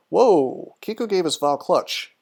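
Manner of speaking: normal talking voice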